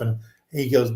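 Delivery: usual speaking voice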